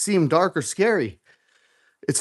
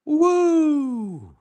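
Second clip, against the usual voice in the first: whoop of relief; 12 semitones higher than usual. Real, not acted. Finishing a heavy rep.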